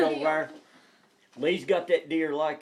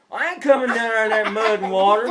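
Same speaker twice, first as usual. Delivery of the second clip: friend calling back; 4 semitones higher, 9 dB louder than usual. Retold or imitated. Voicing friend refusing loudly.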